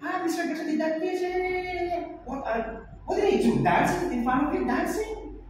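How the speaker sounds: high, fast mocking voice of the school owner. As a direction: rattle it off high and quick like the dismissive owner. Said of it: "I asked the owner of that school".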